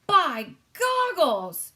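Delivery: loud, high, slow giant exclamation; cried out. A narrator's reading. Giant crying out over his breakfast. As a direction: bellow it in delight. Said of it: "he cried".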